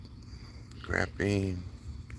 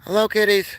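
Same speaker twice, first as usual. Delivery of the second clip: cooing at kittens; 11 semitones higher, 5 dB louder than usual. Real, not acted. Streamer greeting kittens outside, higher cooing voice. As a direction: call sweetly to the kitties.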